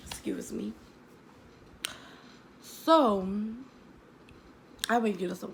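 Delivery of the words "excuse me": whispered, softer apology after a cough; whispered excuse. Real, not acted. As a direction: quietly excuse yourself. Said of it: "after a cough".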